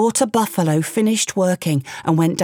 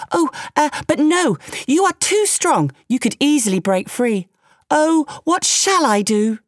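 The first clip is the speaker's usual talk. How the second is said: higher, eager, fawning man's voice; a quick-talking man flattering a tiger, pitched up; frightened man; 6 semitones higher than usual. Acted. The man, scared of Tiger, fawns and plays along, higher. Man feigns dismay again.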